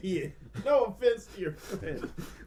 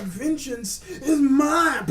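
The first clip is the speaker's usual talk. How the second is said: dramatic villain line; 8 semitones higher, 4 dB louder, much slower than usual. Pretend. Dramatic quote in banter.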